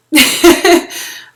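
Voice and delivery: a real laugh that is half a sob; teary laugh. Real, not acted. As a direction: laugh loudly to keep the tears away. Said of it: "Okay, I'm not gonna cry".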